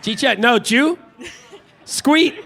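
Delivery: laughing Pittsburgh Yinzer attempt; Pittsburgh accent, high and giggly. Shown as a demonstration. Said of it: "I love Yinzer".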